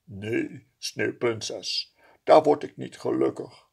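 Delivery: calm him saying no; gentle refusal. A narrator's reading. Fading snowman refuses gently. (zachte weigering)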